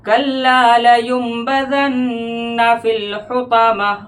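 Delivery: slow, sung tajweed recitation; Quran recitation. Shown as a demonstration. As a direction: chant the verse with full tajweed melody. Recites verse melodically.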